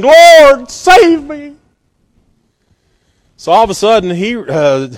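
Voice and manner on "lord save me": high, loud panicked cry for help; a sinking man shouting to Jesus; crying out in fear. Retold or imitated. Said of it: "he cried".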